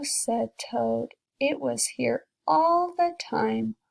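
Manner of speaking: storybook read aloud to a class; teacher reading a picture book to kids, gentle storytelling; reading to children. A narrator's reading.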